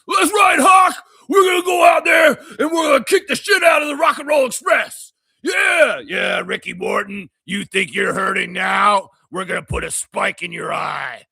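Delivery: screaming high wrestler promo; wrestling promo shout. Retold or imitated. Host doing a Road Warriors promo, screaming. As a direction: yell the promo at the camera. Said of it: "Animal and Hawk from the Road Warriors".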